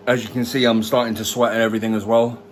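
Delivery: normal talking voice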